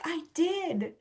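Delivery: small, high-voiced comeback, acted out; retold defensive reply. Retold friend answers back defensively.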